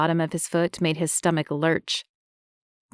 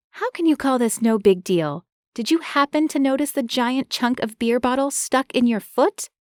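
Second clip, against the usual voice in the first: flustered woman voice; 6 semitones higher than usual. Acted. Marie flustered.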